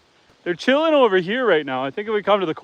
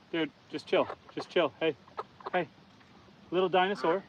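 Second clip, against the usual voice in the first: much softer, calming the frightened chicken; soothing murmur; 13 dB quieter than usual. Real, not acted. Farmer soothing a panicked chicken in much softer tones. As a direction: hush it like you're gentling a scared bird. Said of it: "we are the chicken whisperer right now".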